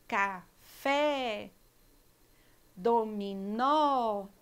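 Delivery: slow word list, final syllable emphasised; last syllable stressed. Shown as a demonstration. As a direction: hit the last syllable of every word, like a teacher. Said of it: "pronunciada com mais força".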